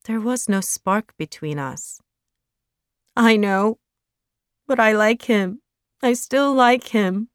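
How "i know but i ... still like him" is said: tearful plea, pitched up and louder. Acted. To Jeremiah, a tearful insistence. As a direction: near tears, insisting she still likes him. Belly pleads, about to cry.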